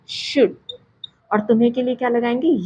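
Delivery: usual speaking voice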